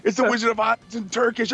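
high, thrilled, hammed-up; drunk excitement. Buying Turkish Wizard of Oz drunk, high gushing. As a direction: gush like a drunk shopper.

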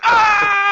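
loud, very high scream; sudden piercing shriek. Retold or imitated. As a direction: let out a full re-enacted nightmare scream. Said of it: "I just started screaming".

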